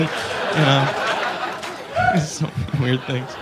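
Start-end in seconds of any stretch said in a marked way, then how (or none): none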